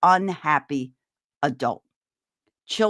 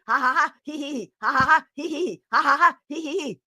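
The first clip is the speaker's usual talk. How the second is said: fake laughter exercise; 5 semitones higher, 4 dB louder than usual. Shown as a demonstration. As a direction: laugh drill building faster and faster. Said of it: "we're gonna go faster and faster and faster".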